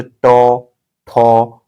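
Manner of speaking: ट, ठ said singly with the tongue curled back; ट and ठ with rounded mouth, tongue bent back; retroflex sounds. Shown as a demonstration. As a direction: demonstrate retroflex ट and ठ, each held apart. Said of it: "जीभ देखिए मुड़ रहा है".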